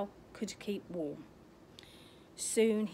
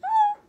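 very high, loud shriek of fright; hammed-up startled scream; 14 dB louder than usual. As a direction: make up for the missing scare noise with a shriek. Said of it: "Didn't really do a fright noise".

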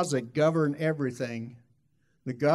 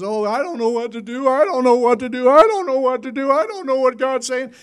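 loud, high-pitched mock panic; hammed-up cry of someone lost; frantic helplessness; 9 semitones higher, 11 dB louder than usual. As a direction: wail it helplessly, building louder. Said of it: "our whole attitude is".

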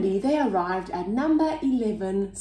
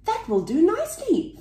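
delighted; 12 semitones higher than usual. A narrator's reading. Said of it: "thought Norman".

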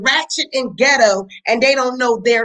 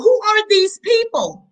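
incredulous; 8 semitones higher than usual. Real, not acted. Incredulous rant at vlogger.

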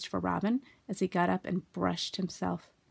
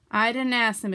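louder, offhand disclaimer; careless; 3 semitones higher, 7 dB louder than usual. A narrator's reading. Rabbit disowns his friends and relations, carelessly. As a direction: shrug the blame away. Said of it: "explained Rabbit carelessly".

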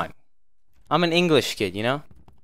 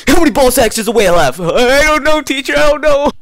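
put-on high, loud whiny kid; whiny student; 7 semitones higher, 5 dB louder than usual. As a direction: act a clueless kid. Playing dumb student.

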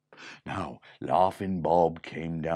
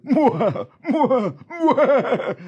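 high, loud mock villain laugh; evil mwah laugh; 12 dB louder, much slower than usual. A narrator's reading. Laughing bandit fleeing, with a high loud mwah laugh. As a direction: cackle mwah mwah mwah. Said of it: "Laughing Bob".

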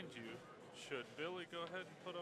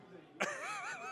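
high burst of laughter; amused laugh; 5 dB louder than usual. Real, not acted. Commentator amused by the hooked player, high laugh. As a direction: laugh out loud, tickled.

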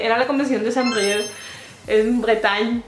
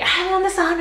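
high, exaggerated eager greeting; mock eagerness; 6 semitones higher than usual. Imagining herself rushing up to Spanish speakers, mock eager. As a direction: pounce on them with put-on excitement.

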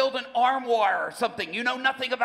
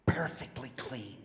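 whisper; 10 semitones lower, 9 dB quieter, slower than usual. Acted. Fishermen finished cleaning the nets.

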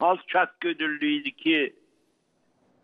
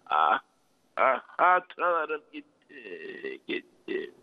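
grieving lament; 6 semitones lower, 3 dB quieter than usual. Real, not acted. Mourning a lost friend.

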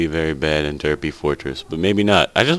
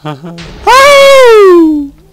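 laughing scream; 13 dB louder than usual. Real, not acted. Panicked laugh-scream while hiding.

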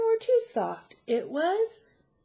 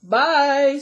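loud, booming papa bear; great big voice; 4 semitones higher, 11 dB louder than usual. A narrator's reading. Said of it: "in his great big voice".

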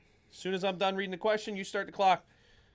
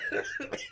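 choking cough; coughing fit. Real, not acted. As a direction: cough up a drink that went down wrong. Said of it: "Are you all right?".

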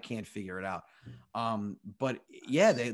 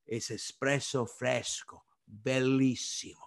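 slow, savored Italian pronunciation; Italian accent; slower than usual. Retold or imitated. Restaurant owner presents frozen espresso to a guest, Italian accent. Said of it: "starts speaking Italian to me".

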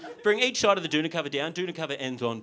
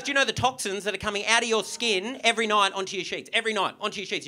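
raised, indignant lecture; higher-pitched scandalised scolding; appalled rant; 6 semitones higher, 4 dB louder than usual. Retold or imitated. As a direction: imitate a disgusted stranger telling someone off. Shocked man rants, acted.

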